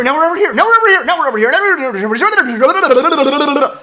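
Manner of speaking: escalating mock exasperation until it breaks into laughter; mocking the jumpy editing, building to a laughing yell; mock frantic. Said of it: "it's like".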